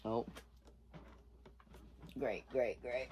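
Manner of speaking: flat sarcastic great great great. Real, not acted. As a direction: mutter it, fed up. Drill failing again.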